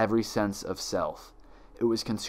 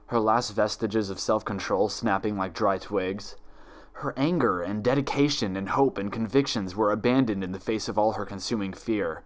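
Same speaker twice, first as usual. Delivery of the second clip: plain reading voice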